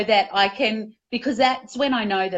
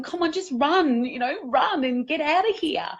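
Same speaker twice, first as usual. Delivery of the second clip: higher-pitched, coaxing urge to flee; urgent inner protector; 6 semitones higher than usual. Acted. Need to be safe talking to the speaker, urging escape. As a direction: plead with yourself to escape. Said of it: "that need to be safe is going".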